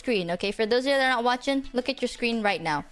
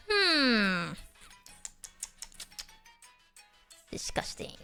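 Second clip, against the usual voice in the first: whispered disgust; 3 semitones lower, 6 dB quieter, slower than usual. Pretend. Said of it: "I'm like".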